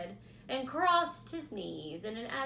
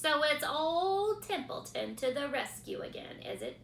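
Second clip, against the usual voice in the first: higher, louder sneering rat; disgusted mockery; 9 semitones higher, 6 dB louder than usual. A narrator's reading. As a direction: rat mocking everyone's demands. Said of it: "he said in disgust".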